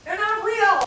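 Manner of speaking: high and loud, teasing; playful loud tease; loud playful exclaim. Real, not acted. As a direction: shout the punchline. Joking to audience.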